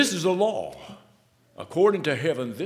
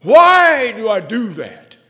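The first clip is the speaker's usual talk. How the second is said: loud, high, slow question to yourself; anguished self-reproach; 10 semitones higher, 17 dB louder, slower than usual. Retold or imitated. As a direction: cry out at your own failing.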